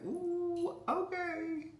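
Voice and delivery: squeaky voice. Retold or imitated. Answering the dogs in a squeak.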